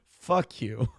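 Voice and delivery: ordinary talk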